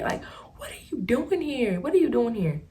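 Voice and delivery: shocked whisper. Retold or imitated. Spotting the old classmate in line, shocked whisper. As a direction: hiss it out in disbelief.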